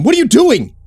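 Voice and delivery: high, loud frightened shout; panicked cry. A narrator's reading. Frightened man confronts armed Steve, panicked high cry.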